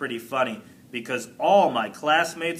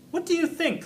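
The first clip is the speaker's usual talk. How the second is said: bright teacher question; 14 semitones higher, faster than usual. A narrator's reading. Teacher asks class brightly.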